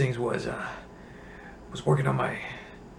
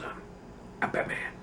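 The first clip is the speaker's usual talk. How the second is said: quiet Batman voice; 7 dB quieter than usual. Retold or imitated. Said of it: "just goes silent and just goes".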